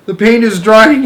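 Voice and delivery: high, loud moan. A narrator's reading. Centipede moaning as the paint dries. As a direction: whine it out in distress. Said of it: "he moaned".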